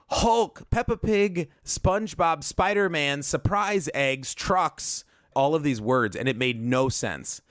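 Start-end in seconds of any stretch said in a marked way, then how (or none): 0.1-5.0 much higher, each keyword called out like a silly list; sing-song mockery of a stuffed title, falling back down; mock title reading.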